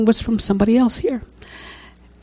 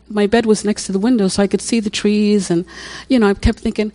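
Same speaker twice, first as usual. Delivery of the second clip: ordinary delivery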